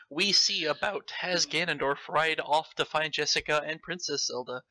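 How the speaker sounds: ordinary talk, nothing marked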